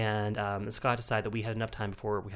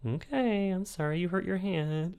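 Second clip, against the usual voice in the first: higher placating husband voice; imitating husband; 8 semitones higher than usual. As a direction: soothe like a patient husband. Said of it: "he's like".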